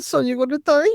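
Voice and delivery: high, pleading warning; a worried dad begging his son; father's warning. Retold or imitated. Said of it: "And he said".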